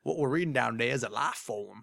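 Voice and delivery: bad Southern accent. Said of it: "bad Southern accent on my part".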